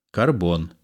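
ordinary talk, nothing marked

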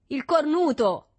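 high, loud, slow jeer; drawn-out mocking shout; jeering heckle. A narrator's reading. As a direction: call out the insult tauntingly. Jeering interruption by a boy.

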